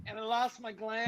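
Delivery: slightly high prank-caller character voice. Retold or imitated. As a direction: quote it in a put-on comic character voice. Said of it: "that's what he was just quoting".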